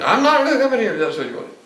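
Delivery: a laughing retort to the church; laughing mock quote. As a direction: toss off the quote while laughing.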